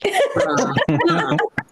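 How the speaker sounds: strained squeal like a cat in distress; gym grunt. Shown as a demonstration. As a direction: let out a pained, squeaky grunt. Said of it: "this is my gym grunt".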